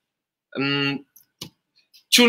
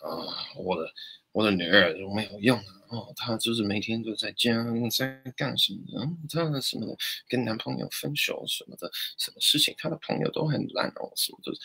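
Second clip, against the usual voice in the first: muttering mother, much softer and partly unvoiced; mother's muttering; 3 semitones lower, 9 dB quieter, faster than usual. Teacher imitates a mother muttering complaints about her daughter, softly. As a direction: grumble under your breath like a nagging mum. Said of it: "母亲就是".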